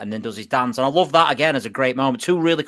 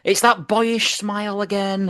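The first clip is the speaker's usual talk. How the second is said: high, slow commentator voice; drawn-out imitated commentary, pitched up; Brian Moore; 7 semitones higher, slower than usual. Host quotes the commentator on the goal scorer, high slow call. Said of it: "it's Brian Moore".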